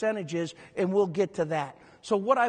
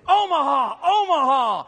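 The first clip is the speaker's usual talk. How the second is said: loud, higher imitated stadium yell; imitating screaming; 5 semitones higher, 6 dB louder than usual. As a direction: shout it like you heard it from the stands. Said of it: "I could hear him screaming".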